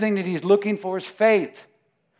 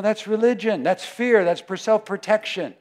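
ordinary talk, nothing marked

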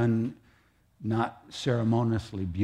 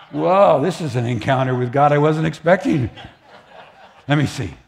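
laughing disbelief; 4 semitones higher, 8 dB louder than usual. Acted. Abram voiced laughing.

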